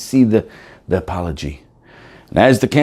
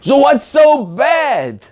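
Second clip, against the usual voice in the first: mock puzzlement; 4 dB louder, much slower than usual. Real, not acted. Rhetorical challenge.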